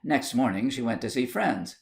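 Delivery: plain reading voice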